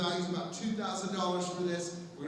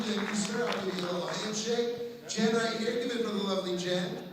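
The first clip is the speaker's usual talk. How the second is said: ordinary talk, nothing marked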